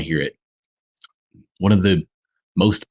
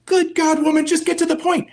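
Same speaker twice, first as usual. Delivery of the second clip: far higher, hammed-up exasperated husband; exasperated man. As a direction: throw up your hands in mock despair. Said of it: "it's like".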